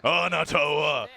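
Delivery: slow epic announcer. Pretend. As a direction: draw it out like a trailer. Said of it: "the announcer voice".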